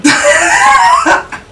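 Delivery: very high squealing laugh; shrieking laugh. Real, not acted. Laughs at story being done.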